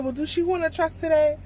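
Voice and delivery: a light mock of a curious caller; lady on the phone.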